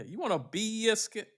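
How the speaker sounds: mock Southern offer.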